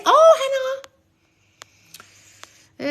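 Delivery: squeals a viewer's name; delighted hello to a fan; high delighted greeting. Real, not acted.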